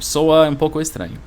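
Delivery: normal talking voice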